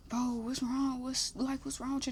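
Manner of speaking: lightly voiced puzzled date; a hushed, half-hearted worried imitation; quiet mock concern. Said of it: "and she's like".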